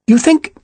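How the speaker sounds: "You think" high-pitched, left hanging; "You think" pitched high and cut short, tentative; unfinished question. A narrator's reading. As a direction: start to ask "You think", then break off. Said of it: "but did not finish it".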